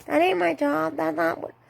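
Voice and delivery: high, whining mock complaint; whiny coworker. Manager mocking a whiny coworker, whiny high voice. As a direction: mimic a coworker whining about doing a task. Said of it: "would whine".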